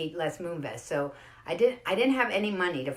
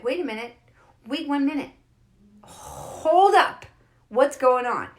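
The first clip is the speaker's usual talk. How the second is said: higher, louder, building to a halt; startled objection; 7 semitones higher, 8 dB louder, slower than usual. Acted. Everybody sitting still suddenly catches on, startled objection. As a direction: call out a mounting "hold on".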